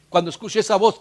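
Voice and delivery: usual speaking voice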